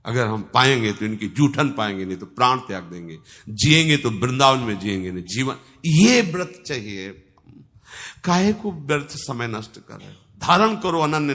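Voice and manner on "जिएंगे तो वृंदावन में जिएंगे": ordinary talk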